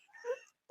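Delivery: a soft high sobbing hum, almost swallowed; choked little sob through closed lips; tearful whimper. Acted. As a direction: hold back a sob as you say goodbye. Daughter parting tearfully from mother.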